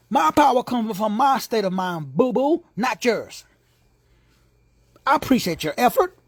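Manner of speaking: ordinary delivery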